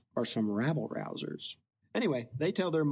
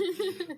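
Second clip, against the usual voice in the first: laugh. Real, not acted. Laughing at own joke.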